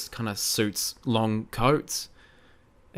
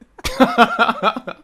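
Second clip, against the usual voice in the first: high, bursting giggle; burst of laughter; 10 semitones higher, 4 dB louder than usual. Real, not acted. Cracking up after a failed accent, high giggle. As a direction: laugh helplessly at yourself. Amused at her own accent.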